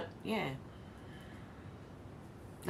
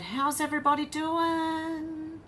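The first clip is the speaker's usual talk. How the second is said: much higher, slow, lilting question; singsong chat greeting; 10 semitones higher, slower than usual. Retold or imitated. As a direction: read it like a cheery sung greeting. Reading chat greeting playfully.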